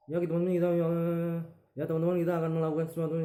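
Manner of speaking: fast, high, slurred rush of words; mumbled, garbled articulation. Shown as a demonstration. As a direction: gabble it so nothing is clear. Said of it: "Nah, itu nggak jelas". (artikulasi berantakan, cepat dan tidak jelas)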